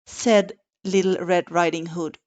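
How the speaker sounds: ordinary delivery, nothing marked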